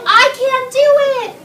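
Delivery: high, full-voiced shout. Shown as a demonstration. Teacher models Ryan's line for class, shouted. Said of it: "Ryan shouted".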